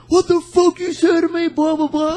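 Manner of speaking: very high, loud mock drunken yell; hammed-up belligerent shout; drunk guy fired up. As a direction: imitate an angry drunk squawking back. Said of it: "this one really, really drunk guy".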